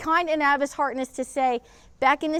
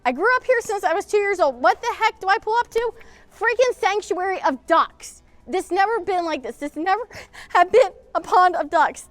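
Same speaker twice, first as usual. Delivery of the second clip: higher, louder, indignant outburst; upset rant; 6 semitones higher, 4 dB louder than usual. Real, not acted. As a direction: vent at the town's neglect. Ranting about ruined childhood pool.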